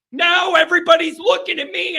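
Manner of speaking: usual speaking voice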